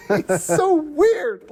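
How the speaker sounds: drawn out through laughter; laughing. Real, not acted. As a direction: stretch it out, still laughing. Laughing reaction to scene.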